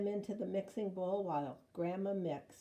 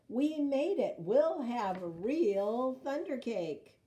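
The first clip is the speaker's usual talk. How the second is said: excited; 5 semitones higher, 6 dB louder, slower than usual. A narrator's reading. Triumph after the race.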